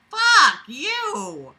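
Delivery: sudden high, loud outburst; outraged yell. Real, not acted. Reader yelling at the story's writer in outrage. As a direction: fling it at the writer.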